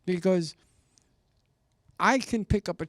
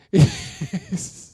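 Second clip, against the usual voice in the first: a high burst of laughter, louder; 12 semitones higher, 7 dB louder than usual. Real, not acted. Loud laugh after joke.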